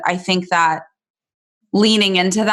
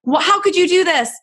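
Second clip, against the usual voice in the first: much higher retold furious yell; angry outburst; 11 semitones higher than usual. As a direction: fling the insult at him, hurt. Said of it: "when I was like".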